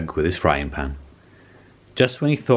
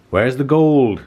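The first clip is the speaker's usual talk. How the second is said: higher, louder demanding shout; pirate chief shouting a demand; angry shout; 6 semitones higher, 5 dB louder, slower than usual. A narrator's reading. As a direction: bellow at the troll for the gold. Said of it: "shouted Hank Chief".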